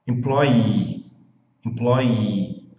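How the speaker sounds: employee ending in a long I sound; employee, final ee held long; model pronunciation. Shown as a demonstration. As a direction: stress the ee of employee, long and clear. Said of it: "com som de I".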